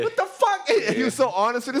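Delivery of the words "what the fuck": squeaky, much higher mock outrage; a jump way up into a disbelieving squeal; Chappelle's outraged dad. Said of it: "He's like".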